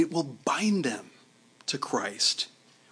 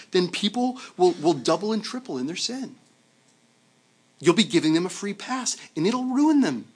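objecting churchgoers; 6 semitones higher than usual. Retold or imitated. Churchgoers warning Paul, high alarmed protest. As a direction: plead with Paul in mounting alarm, much higher. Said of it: "they said something like".

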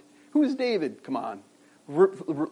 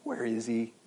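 lost, quiet lament; 9 dB quieter, slower than usual. Retold or imitated. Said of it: "when we feel like".

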